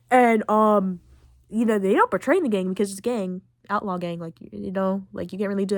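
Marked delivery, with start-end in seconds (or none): none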